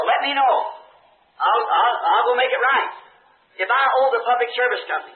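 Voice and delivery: fervent prayer. Acted. Preacher praying aloud before the congregation, pleading and fervent. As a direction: beg the Lord to show the wrong.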